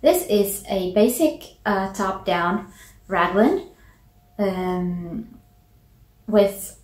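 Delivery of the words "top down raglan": ordinary delivery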